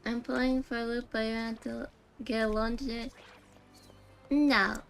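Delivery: ordinary delivery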